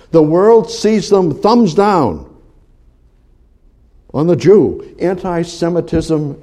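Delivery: loud, high, slow preacher's delivery; high, ringing sermon with drawn-out stresses; forceful preaching. Real, not acted. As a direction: drive each phrase home to the congregation.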